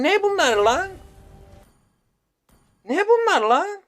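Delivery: building from a sharp demand to a high, loud furious shout; angry yelling. Real, not acted. Gamer yelling at the junk he got, furious. Gamer raging at match items.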